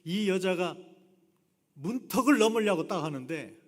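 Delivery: ordinary talk, nothing marked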